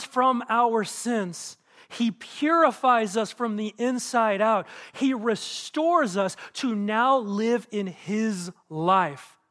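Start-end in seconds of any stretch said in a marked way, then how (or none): none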